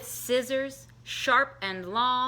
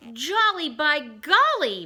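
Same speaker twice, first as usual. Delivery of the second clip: higher, louder burst of glee; jolly exclamation; 5 semitones higher, 6 dB louder than usual. A narrator's reading. Barnaby delighted with the trimmed tree, loud and jolly. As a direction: kick up your heels on it. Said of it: "Barnaby said with a kick".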